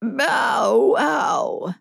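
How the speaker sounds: a hoarse shouted bow wow; hoarse dog bark. A narrator's reading. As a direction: bark it out like an old yard dog. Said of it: "barked the old yard dog".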